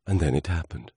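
ordinary delivery, nothing marked

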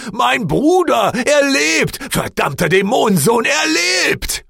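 very high, loud, cracking with joy; overjoyed shout. A narrator's reading. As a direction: scream it out in jubilant disbelief. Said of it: "seine Worte überschlugen sich fast vor Freude".

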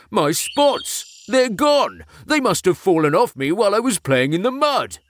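high, rising alarm at the loss; shocked. Acted. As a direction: gasp out the discovery in a high voice. Discovers his spots are gone.

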